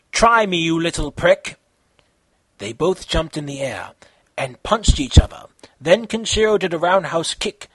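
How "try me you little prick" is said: plain reading voice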